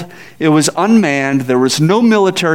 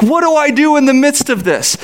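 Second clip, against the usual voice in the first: overwhelmed panic; 8 semitones higher than usual. Retold or imitated.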